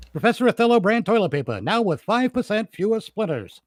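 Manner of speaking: high, fast radio pitchman. Shown as a demonstration. Writer rereads the corrected ad as pitchman. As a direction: rattle off the ad like an announcer. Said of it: "I've got that pitchman voice".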